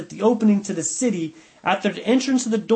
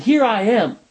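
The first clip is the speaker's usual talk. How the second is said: calling out as Wisdom; 5 semitones higher, 4 dB louder than usual. Acted. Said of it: "Wisdom is saying".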